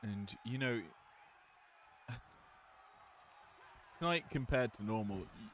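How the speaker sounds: normal talking voice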